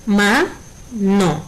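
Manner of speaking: MA, NO as two separate beats; teacher dividing mano into Ma and no; syllable by syllable. Shown as a demonstration. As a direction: split mano into Ma, pause, no. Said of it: "es una palabra de dos sílabas".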